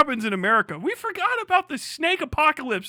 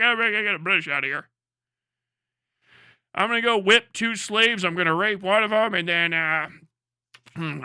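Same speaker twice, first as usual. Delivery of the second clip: lower, gruff-ish put-on voice, casually evil; mock founding father; 7 semitones lower than usual. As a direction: brag like a smug colonist. Mocking a founding father's plans.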